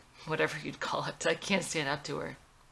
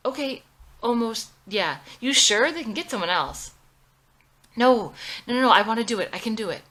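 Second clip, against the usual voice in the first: eager insistence; 6 semitones higher, 10 dB louder than usual. Acted.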